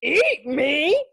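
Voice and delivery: high, loud outraged cry; shriek. Acted. Donut learns he was about to be eaten, shrieking. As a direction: shriek it in disbelief. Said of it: "Arnie shrieked".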